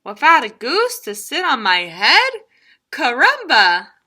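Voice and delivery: high chicken voice, questioning; a chicken tempted by the idea; curious chicken. Acted. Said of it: "cried the chicken".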